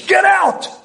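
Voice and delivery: high-pitched imitated scream; high, commanding outcry; screamed order. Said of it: "He screams in the air".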